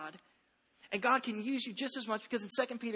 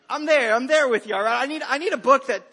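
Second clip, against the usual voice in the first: laughing loudly; 2 semitones higher, 10 dB louder than usual. Real, not acted. Laughing loud agreement.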